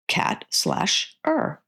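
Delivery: slow, breathy reading of "cat slash er", split into syllables; syllables read apart. Shown as a demonstration. A tutor reads the word pyramid line to parents, slow and syllable by syllable. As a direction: sound out "cat slash er" piece by piece in a whisper. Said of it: "show the next line with two syllables".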